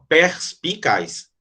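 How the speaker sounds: "perspicaz" slowly as pers, pi, caz; syllable by syllable. Shown as a demonstration. Teacher telling pupils just to say it, "perspicaz" in three slow beats. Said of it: "Só é você falar".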